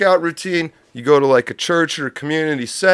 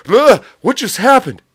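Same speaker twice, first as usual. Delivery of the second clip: Will Ferrell dazed; 7 semitones higher than usual. Retold or imitated. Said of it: "I blanked out there like Will Ferrell".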